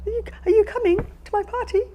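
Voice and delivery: high, loud, tearful mock worry; worried client. Worried client phoning about the party, hammed-up distress. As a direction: whine anxiously in a hammed-up high voice.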